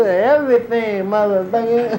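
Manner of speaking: ordinary delivery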